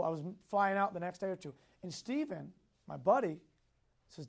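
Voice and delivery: ordinary delivery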